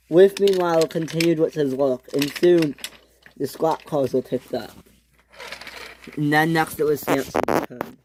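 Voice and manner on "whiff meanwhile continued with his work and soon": usual speaking voice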